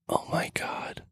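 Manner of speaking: whispered secret, all breath and no voice; child's whisper. Retold or imitated. Child telling parent the F word, whispered. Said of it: "whisper it in my ear".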